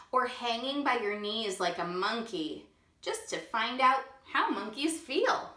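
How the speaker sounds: ordinary delivery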